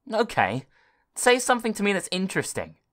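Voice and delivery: put-on quick cheeky kid; demanding boy. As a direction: push the computer impatiently.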